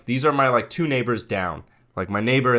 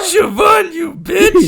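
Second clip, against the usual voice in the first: mock voice crack; 10 dB louder, much slower than usual. Mocking the voice crack.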